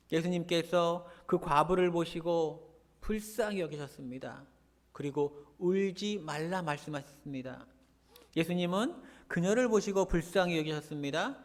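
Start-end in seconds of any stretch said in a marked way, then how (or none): none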